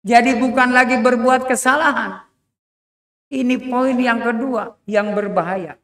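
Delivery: thundering sermon voice; fiery preaching. Acted. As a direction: hammer the warning to the crowd. Preacher at full force.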